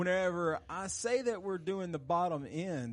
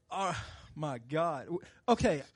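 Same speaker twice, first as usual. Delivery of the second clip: ordinary talk, nothing marked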